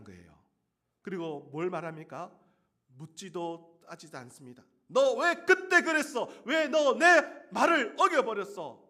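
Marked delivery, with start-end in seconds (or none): 4.9-8.8 high, loud reproachful voice; mock scolding. Pastor voicing God's imagined scolding of Jonah, loud. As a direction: scold him loudly, then retract it.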